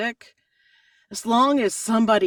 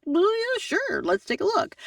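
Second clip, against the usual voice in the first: eager yes; 14 semitones higher than usual. Retold or imitated. Retelling own eager reply.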